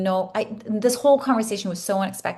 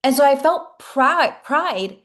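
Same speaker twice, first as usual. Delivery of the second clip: higher and louder, slowed, stretching out "pride"; swelling pride; 6 semitones higher, 5 dB louder, much slower than usual. Real, not acted. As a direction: let the pride rise in the voice, slowly. Proud of not needing redemption.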